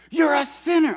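high, loud caricature shout; hammed-up street-preacher accusation; mock bullhorn yell. Mocking the street preacher yelling at him, loud and high.

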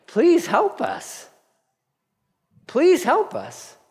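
high, slow plea; pleading children. Retold or imitated. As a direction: beg like a frightened child. Children crying out to God.

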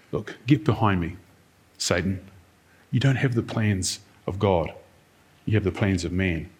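calm, quiet and plain, no yelling; simply said. Shown as a demonstration. Preacher showing Jesus gently correcting Peter, quiet. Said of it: "if Jesus just simply said to Peter".

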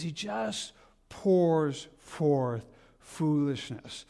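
drawn-out preacher emphasis; very slow emphasis. Shown as a demonstration. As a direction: draw out pours forth foolishness very slowly. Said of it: "he says".

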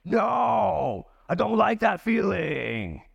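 high, drawn-out complaint to God; mock protest. Preacher acting out his objection to the Lord, mock high protest. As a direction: play up the protest, high and stretched. Acted protest to God's plan.

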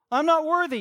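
loud, high, exaggerated confession; over-the-top mock despair; mock self-loathing sinner. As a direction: wail it out like a hammed-up penitent. Acts out a sinner confessing to pastor.

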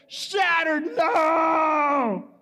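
high, hammed-up wail; a high mock cry of despair; mock heartbreak. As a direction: cry out as if crushed. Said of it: "I was like".

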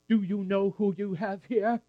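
high, bubbling question; barely contained old woman's excitement; Anna excited. Acted. Anna recognises the baby Jesus, asking Joseph in high excitement. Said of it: "she began to bounce".

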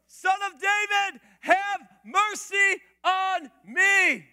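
high, loud, slow cry for mercy. Acted. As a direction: stretch out the plea at full voice. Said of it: "he shouted all the more".

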